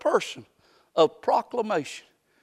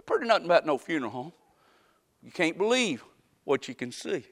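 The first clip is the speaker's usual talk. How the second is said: normal talking voice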